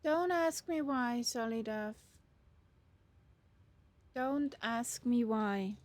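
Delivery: normal talking voice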